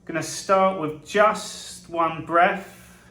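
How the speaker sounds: very slow meditation voice with long drawn-out words; guided meditation. Acted. Guide leading listeners to their safe space, slow guided meditation. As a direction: ease them into calm, leaving long silences between short phrases.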